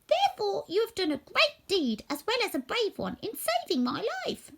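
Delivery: squeaky mouse queen. A narrator's reading. Mouse queen praising the woodman, high squeak. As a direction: thank him royally, squeaking.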